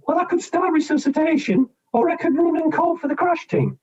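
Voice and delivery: high, nervous nurse listing options; high panicky voice. Retold or imitated. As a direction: squeak out the choices in panic. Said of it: "Very high-pitched, panicky voice".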